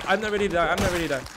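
loud cry of dread; panicked plea. Real, not acted. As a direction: yell it as death closes in. About to die in game.

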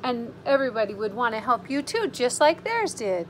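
plain reading voice

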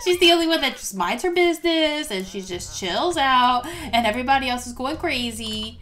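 normal talking voice

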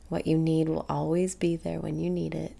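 normal talking voice

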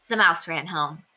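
ordinary delivery, nothing marked

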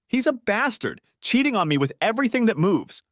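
high, bitter rant about a cheating husband; bitter outburst. Acted. Wife rants about cheating husband.

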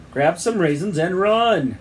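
slow, high urgent shout; drawn-out call over the din; shouting over noise. A narrator's reading. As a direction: yell urgent orders, stretching the last word. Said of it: "shouted Sid over t".

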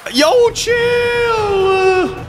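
high, stretched-out shout; panicked yell. Real, not acted. Yelling at monster in game.

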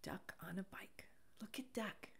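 whispered title, soft and secret; hushed. Real, not acted. Whispering the book title to a child. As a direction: hush it like a bedtime secret.

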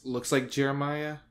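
ordinary talk

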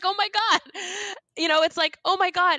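high, loud gush of amazed delight; overwhelmed exclamation. Retold or imitated. Acting out her overwhelmed bliss.

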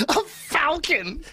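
high, slow laughing repeat; laughing disbelief. Real, not acted. Laughing in disbelief at Joey's falcon jab. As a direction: crack up over the word falcon.

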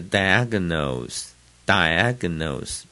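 diagnose slowly, weight on DI; stress at the front. Shown as a demonstration. Teacher showing the verb form, diagnose stressed up front. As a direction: hit the first syllable of diagnose. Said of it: "重音就在最前面了".

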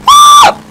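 very high loud screech; strained yell that jumps into a squeal; bird on crack squawk. Shown as a demonstration. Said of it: "I sound like a fucking bird on crack".

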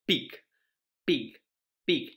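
pig said with a short English i; teacher modelling the word pig. Shown as a demonstration. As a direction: say pig slowly and clearly for children. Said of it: "enseñando solo las imágenes".

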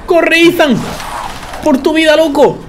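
high-pitched, frantic call; panicked urging. Real, not acted. Player to Ethan fleeing an enemy, panicked urging. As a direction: urge him to flee for his life. Urging game character to flee.

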